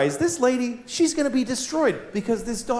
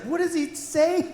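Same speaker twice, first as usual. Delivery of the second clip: curious little daughter; 11 semitones higher than usual. Retold or imitated. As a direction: ask like a curious little girl. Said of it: "My daughter's like".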